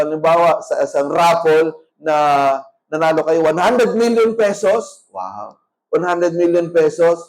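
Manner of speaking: normal talking voice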